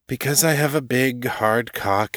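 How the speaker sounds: mildly weary, resigned reply; tired. A narrator's reading. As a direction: recite it with tired patience. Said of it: "he said tiredly".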